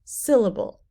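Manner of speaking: "Syllable" with the first syllable punched, falling after; stress on first syllable. Shown as a demonstration. Teacher shows natural stress in a word, first-syllable stress. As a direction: say "Syllable" hitting SYL, the rest lighter. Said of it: "the first syllable in syllable is said more loudly".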